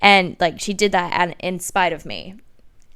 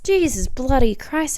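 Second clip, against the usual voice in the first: self-mocking exclamation; 6 semitones higher, 4 dB louder than usual. Real, not acted. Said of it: "I sound like a freaking hussy".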